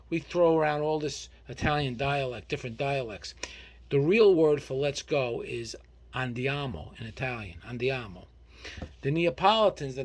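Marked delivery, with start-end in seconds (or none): none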